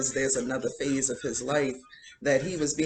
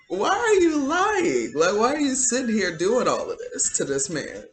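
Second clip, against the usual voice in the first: incredulous protest; 9 semitones higher, 5 dB louder than usual. Retold or imitated. Challenging a witness she thinks is lying, incredulous. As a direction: wail the question high, then ease down.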